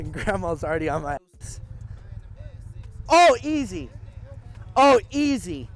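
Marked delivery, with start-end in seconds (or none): 3.1-3.9 loud call, easy now. Real, not acted. Calling out to grandma. 4.8-5.7 loud teasing call. Real, not acted. Repeats the call.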